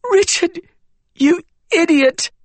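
very high, angry cry; seething. A narrator's reading. Wren rages at her dying cousin, seething. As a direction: curse him through tears. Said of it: "but seething now".

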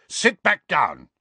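slow, high, forceful command; bellowed order. A narrator's reading. Uncle ordering nephew to sit, bellowing. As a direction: order him down, word by word. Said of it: "bellowed Uncle Vernon".

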